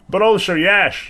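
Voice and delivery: high, dry afterthought; deadpan halfling. Acted. Halfling adds a dry punchline to Zell, high. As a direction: drop it as a cheeky punchline. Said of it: "The pause and he goes".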